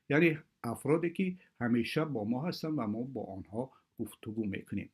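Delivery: ordinary talk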